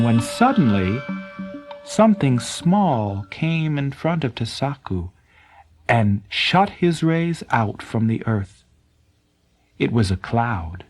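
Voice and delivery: ordinary delivery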